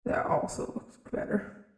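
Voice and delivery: breathy voice changer setting; changed voice filter. Shown as a demonstration. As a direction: try the new voice changer setting. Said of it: "I'm changing".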